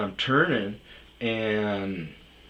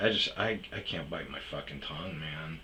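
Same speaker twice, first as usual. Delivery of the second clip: ordinary talk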